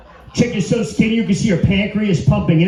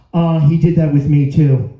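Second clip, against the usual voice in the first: louder, lower mock ex-girlfriend voice; neighbor through wall; 5 semitones lower, 6 dB louder than usual. Ex-girlfriend heard through the wall, louder mocking voice. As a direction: call it through the wall as the jealous ex. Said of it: "this voice coming through the wall".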